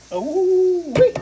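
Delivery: high, drawn-out "ooh-wee"; long rising cry of delight; excited whoop. Real, not acted. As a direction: whoop with relish over the food. Excited cooking at the frying pan.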